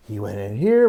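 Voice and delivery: usual speaking voice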